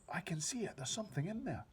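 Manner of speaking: put-on high, excited child's voice; a girl amazed at what she sees; girl spotting the dream, high. A narrator's reading. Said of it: "cried out".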